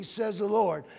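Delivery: ordinary delivery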